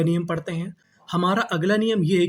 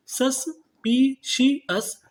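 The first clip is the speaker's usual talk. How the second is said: Suspicious stretched very slowly, higher, falling at the end; slow syllable by syllable; 6 semitones higher, much slower than usual. Shown as a demonstration. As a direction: spell it out slowly for students. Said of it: "तो meaning क्या बनी". (धीरे-धीरे शब्दांश तोड़कर)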